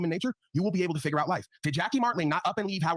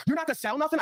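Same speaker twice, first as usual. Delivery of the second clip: father nagging; 10 semitones higher than usual. Retold or imitated. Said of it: "Like my father".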